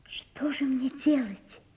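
slow, hushed despairing whisper; helpless whisper. A narrator's reading. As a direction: ask it almost without voice. Natasha helpless before Sonya.

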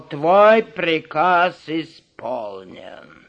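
slow, low, solemn farewell; drawn-out grave announcement; monkey leader voice. Acted. Monkey leader addresses the witch. (медленный низкий голос вожака)

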